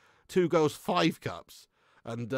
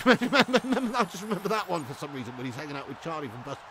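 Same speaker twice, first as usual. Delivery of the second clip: normal talking voice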